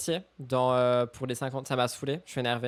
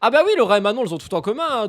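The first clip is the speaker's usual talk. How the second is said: sarcastic; 5 semitones higher, 7 dB louder, faster than usual. Pretend. Sarcastic aside.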